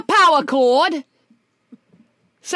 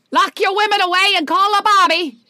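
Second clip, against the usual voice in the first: hammed-up Cockney, a bit louder; mock Cockney warning; 4 semitones higher, 5 dB louder than usual. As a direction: warn the town like a Cockney gossip. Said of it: "your approximation of a Cockney accent".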